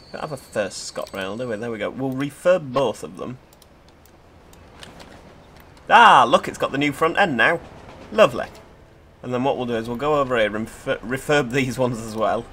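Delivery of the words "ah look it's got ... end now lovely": excited. Real, not acted. Admiring new front end.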